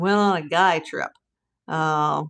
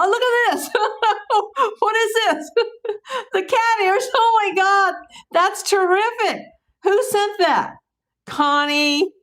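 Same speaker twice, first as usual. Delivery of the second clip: high, giddy, laughing delight over a gift; real delighted squeal over a present; 11 semitones higher, slower than usual. Real, not acted. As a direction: squeal with joy at the surprise. Delighted at gift cat ears.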